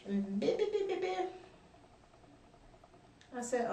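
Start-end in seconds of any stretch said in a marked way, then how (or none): 0.4-1.3 high, quick mock jabber; high-pitched burst of nonsense chatter; mimicked yapping. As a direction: imitate her yapping back at you. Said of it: "cuts her face over at me".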